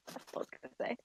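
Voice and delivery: unvoiced whispered aside. Real, not acted. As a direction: whisper it under the breath. Aside after creature voice.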